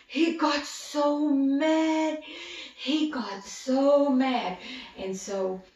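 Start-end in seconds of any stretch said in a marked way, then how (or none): 0.1-4.6 slow, loud, high emphasis on so mad; emphatic. A narrator's reading. Telling children about Esau's anger, slow loud emphasis. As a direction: drag out he got so mad.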